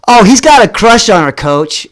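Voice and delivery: blurted tattle. Retold or imitated. Buddy blurts secret to teacher.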